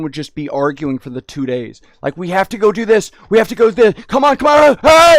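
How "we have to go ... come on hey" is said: high, loud nagging shout, building to a yell; hammed-up impatient parents hurrying everyone; rising mock shout of frantic relatives; mock family yelling. Family arguing on vacation, acted.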